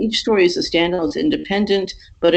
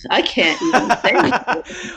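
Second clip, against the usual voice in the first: laughing; 11 semitones higher, slower than usual. Real, not acted. Stumbles over Sisyphus, laughs.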